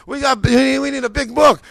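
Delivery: high, loud, slowed disbelief; incredulous protest. Retold or imitated. Husband doubting a little envelope, high incredulous protest. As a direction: protest it as if it cannot be true.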